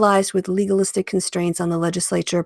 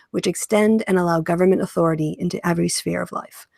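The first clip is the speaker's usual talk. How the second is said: ordinary talk, nothing marked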